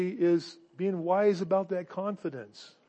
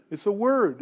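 ordinary talk

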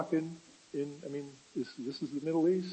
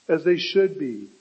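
ordinary talk, nothing marked

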